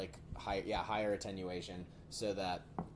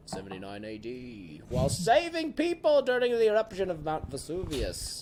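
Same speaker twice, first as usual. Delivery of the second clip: much higher, louder, slow grand narration; dramatic reading voice; 17 dB louder, slower than usual. Shown as a demonstration. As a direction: read it like a pompous documentary. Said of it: "I'll read it like this".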